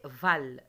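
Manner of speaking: "Val" as one firm, loud beat; isolated syllable. Shown as a demonstration. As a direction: punch out "Val" for pupils to repeat. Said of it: "on va répéter la syllabe en bleu".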